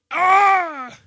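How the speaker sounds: loud high shriek; hammed-up scream; mock scream. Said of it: "He doesn't go".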